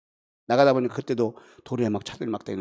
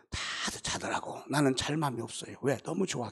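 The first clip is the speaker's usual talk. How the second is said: usual speaking voice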